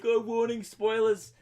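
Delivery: high-pitched mock warning; mocking imitation. Podcaster mimicking people who warn of spoilers, high mocking voice.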